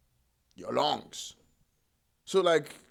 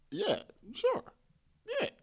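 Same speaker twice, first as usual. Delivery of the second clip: sarcastic. Real, not acted. Sarcastic reply about nerd kid.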